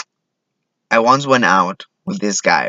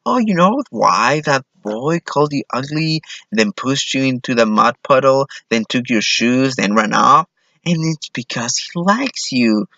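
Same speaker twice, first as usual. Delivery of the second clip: higher, singsong mock parent; parent to little girl; 4 semitones higher than usual. Parent explaining a boy to a little girl, mock reassuring. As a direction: explain it sweetly, as to a child. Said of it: "people tell their little girls".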